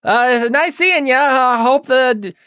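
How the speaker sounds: very high, loud, stretched-out pleasantry; high-pitched, awkwardly halting goodbye; Jack. Acted. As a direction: fumble through small talk in a squeaky-high voice. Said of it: "Jack says".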